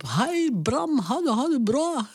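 high, bright call across the yard; imitating a Norwegian farm woman calling out a greeting; farmer's wife calling. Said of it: "riep ze". (hoog roepende boerin die Bram begroet)

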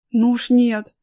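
loud, emphatic no; touch of fear; shuddering refusal. A narrator's reading. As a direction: refuse the idea in alarm. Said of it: "содрогнулся Аркаша".

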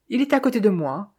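ordinary delivery, nothing marked

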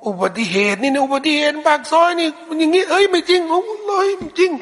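high-pitched, animated account of an accident; excited eyewitness, pitch rising as they go; excited storytelling. Acted. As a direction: act out someone insisting on their story, voice climbing high. Acting someone excitedly telling an accident story.

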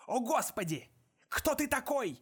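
high, loud panicked shout; terrified cry. A narrator's reading. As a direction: cry out in sudden terror. Killer sees giant appear.

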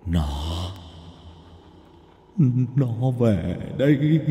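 put-on a dying old man's ghostly voice, rising to a desperate high, loud wail; drawn-out, terrified pleading that climbs into a scream; pitiful wailing. Ghost of old Văn wailing for the old woman to run. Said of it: "vang lên thảm thiết".

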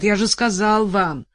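loud, self-satisfied lawyer; pompous lawyer. Acted. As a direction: lecture her smugly about the sentence. Lawyer explains sentence.

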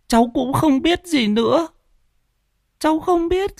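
put-on high child's voice, sobbing out the words; a terrified boy whimpers he knows nothing; whimpering little boy. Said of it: "Thằng cu tít mếu máo". (giọng bé trai mếu máo, rất cao)

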